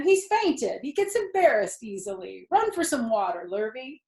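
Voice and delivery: loud, high holler. Acted. Zuckerman hollering back to the loudspeaker, loud.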